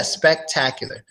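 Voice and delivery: ordinary delivery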